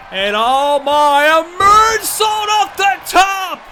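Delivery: high, loud, stretched-out call; wrestling commentator. Real, not acted. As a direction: scream the big dive as it happens. Moonsault off the top rope.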